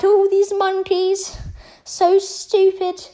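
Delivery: high, slow, sneering villain voice; annoying voice. Acted. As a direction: drag it out with smug contempt. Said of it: "racist Frieza's just there like".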